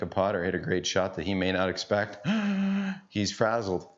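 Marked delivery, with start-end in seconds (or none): 2.3-3.0 a sudden sharp gasp, high. Retold or imitated. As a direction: gasp like the kid caught off guard. Opponent surprises the kid.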